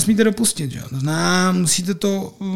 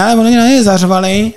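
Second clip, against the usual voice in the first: higher, louder complaint; mock protest; 3 semitones higher, 5 dB louder than usual. Rival team complaining to the referee, mock indignation.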